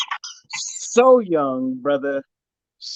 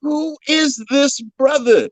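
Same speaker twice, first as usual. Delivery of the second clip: higher, amazed, searching for answers; stunned disbelief; 7 semitones higher than usual. Retold or imitated. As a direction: act baffled by what you see. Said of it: "I was like".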